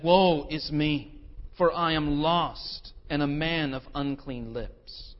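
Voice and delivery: very slow, weighty confession; slow solemn lament. Retold or imitated. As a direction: drag each word out in dread. Isaiah's terrified confession.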